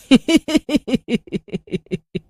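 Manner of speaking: witch cackle. A narrator's reading. Witch cackling after sending the witches out. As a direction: cackle as the coven sets off.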